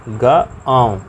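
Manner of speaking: गा, आव broken apart, आव sounded through the nose; sounding out गा, आव slowly; nasal vowel split. Shown as a demonstration. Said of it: "ये क्या बोल रहा है".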